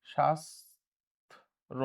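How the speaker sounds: শাস্ত্র very slow and soft, split before the last syllable; option sounded out. Shown as a demonstration. Teacher testing the pronunciation, slow split syllables. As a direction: say শাস, long pause, ত্র, softly. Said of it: "উচ্চারণ নিয়ম অনুযায়ী".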